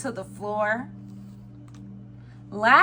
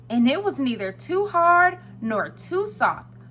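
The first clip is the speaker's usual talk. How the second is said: plain reading voice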